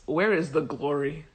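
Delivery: a quiet, broken cry of loss; upset mother. Retold or imitated. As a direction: ask it as a grieving question. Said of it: "This woman is so upset".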